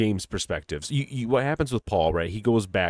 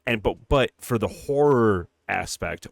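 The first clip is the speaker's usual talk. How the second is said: usual speaking voice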